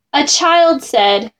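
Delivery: ordinary delivery, nothing marked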